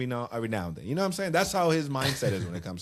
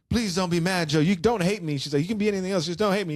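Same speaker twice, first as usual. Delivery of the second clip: higher pitched begging plea; imitating pleading Norma; 7 semitones higher, faster than usual. Norma begs Joe not to hate her, high pleading. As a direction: beg him, voice up high and pleading.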